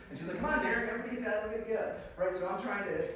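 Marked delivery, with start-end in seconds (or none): 0.4-2.0 quick, raised-pitch mom's call. Retold or imitated. Mom calling the family for a picture, urgent calling out. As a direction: round everyone up in a hurry.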